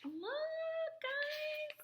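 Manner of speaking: tiny, very high squeal; high-pitched excited squeal; overjoyed squeak. Real, not acted. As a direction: squeak it out in sheer delight. Overcome by the gift, showing it.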